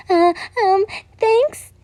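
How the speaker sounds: high, stammering flustered thanks; blushing girl fumbling her thanks. A narrator's reading. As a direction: stumble out the thanks, embarrassed and high. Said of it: "You blushed in embarrassment".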